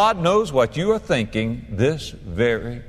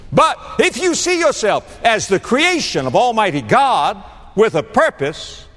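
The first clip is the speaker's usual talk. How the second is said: preaching climax; 9 semitones higher than usual. Real, not acted.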